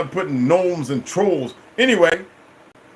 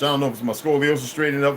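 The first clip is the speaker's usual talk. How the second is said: ordinary talk, nothing marked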